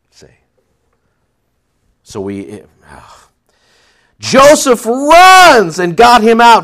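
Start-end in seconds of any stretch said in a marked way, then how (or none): 4.2-6.6 high and loud, slow; loud slow pulpit cry; loud preaching. Real, not acted. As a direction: proclaim it slowly at full force. Preacher builds to loud climax.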